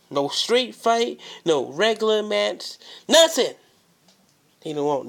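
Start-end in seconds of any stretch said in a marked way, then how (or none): 0.1-3.5 builds to a shout; no street fight, rising to a yell. Acted. Building promo rant.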